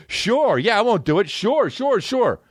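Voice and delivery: high sarcastic agreement; mock-agree, dripping sarcasm; sarcastic brush-off; sarcastic sure. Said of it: "I should have went like this".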